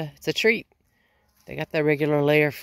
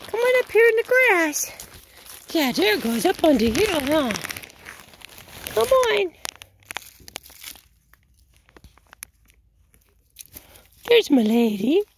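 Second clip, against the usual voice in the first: pet talk. Real, not acted. Feeding oats to her chickens, very high cooing pet talk. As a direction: coo at them like they're babies.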